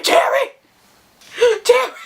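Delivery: high-pitched, panicked scream of a name. Retold or imitated. Storyteller calls the getaway driver who left, screaming. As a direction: scream after someone who has driven off. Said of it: "I'm screaming".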